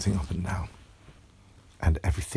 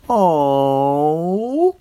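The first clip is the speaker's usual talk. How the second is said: loud, drawn-out sad oh; a crowd of oranges let down; disappointed chorus; 6 semitones higher, 10 dB louder than usual. A narrator's reading. Oranges disappointed at the girl's refusal, loud.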